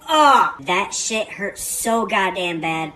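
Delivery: loud, drawn-out retold agony; slow, emphatic mock pain; pained exclamation. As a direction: stretch the words out as if hurting. Recalling a painful fingernail injury.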